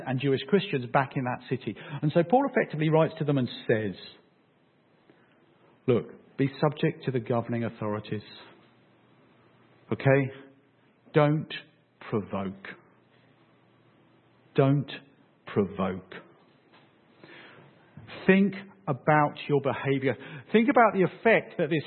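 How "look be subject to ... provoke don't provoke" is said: low, measured caution with weighty pauses; measured warning. Retold or imitated. Preacher voices Paul warning the church in Rome, measured. Voicing Paul's caution to Romans.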